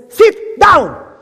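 high, loud order: sit down; firm shout; shouted command. Acted. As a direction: bark it so it ends the argument. Bill Gates orders firmly.

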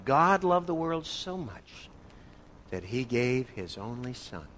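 slow solemn scripture reading, fading; slow reverent reading. Shown as a demonstration. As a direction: read the verse slowly and solemnly. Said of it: "How does this strike you?".

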